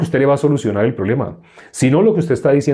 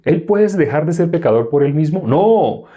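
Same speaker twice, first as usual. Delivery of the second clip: ordinary delivery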